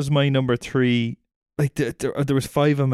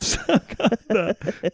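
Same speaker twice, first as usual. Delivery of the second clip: laughing repeat; 8 semitones higher, much slower than usual. Real, not acted.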